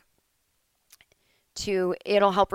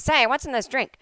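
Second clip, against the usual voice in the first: put-on old movie quote; 6 semitones higher, 4 dB louder than usual. Quoting thirties movie confession.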